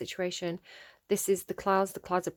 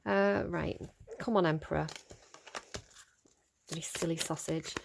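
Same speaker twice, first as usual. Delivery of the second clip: usual speaking voice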